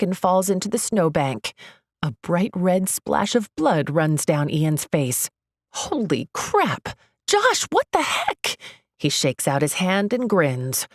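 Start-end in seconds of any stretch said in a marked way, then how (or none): 5.7-8.6 slow gasp, then a much higher shriek; horrified yelp at the punch; shocked outcry. Acted. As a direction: cry out in shock, pitch jumping up.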